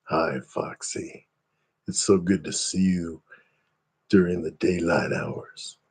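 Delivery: low, soft and very slow greeting; my way of saying it. Shown as a demonstration. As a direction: drop low and quiet, drag it out slowly. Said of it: "I can say it like this".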